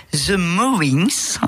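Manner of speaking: slow, careful English accent on the band name; a French host proudly putting on an English accent. Shown as a demonstration. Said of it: "je prends mon plus bel accent anglais".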